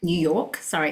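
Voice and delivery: ordinary delivery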